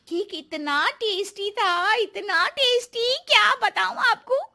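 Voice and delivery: gushing. Acted. Neha gushing about the cake.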